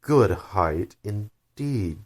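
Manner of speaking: angry. Acted. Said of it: "said the caterpillar angrily".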